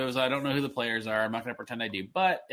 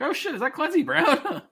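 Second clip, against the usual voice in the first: surprised; 13 semitones higher, 3 dB louder than usual. Retold or imitated. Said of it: "I was like".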